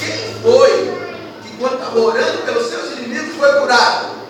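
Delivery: loud preaching question with a high rise. Acted. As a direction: call it out to the church. Preacher asks congregation loudly. (pregação em voz alta)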